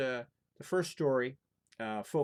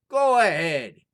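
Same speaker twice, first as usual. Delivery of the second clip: loud, higher careless shout; bold shout; 6 semitones higher, 15 dB louder, slower than usual. Acted. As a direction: call up the chimney without fear. Fearless lad shouts up chimney.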